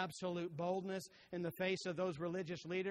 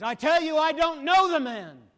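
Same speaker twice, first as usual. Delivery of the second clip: loud, much higher, furious protest; cursing denial; 11 semitones higher, 20 dB louder than usual. Retold or imitated. Peter denying Jesus to his accusers, angry loud shout. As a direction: fling the denial out angrily. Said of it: "Even with cursings".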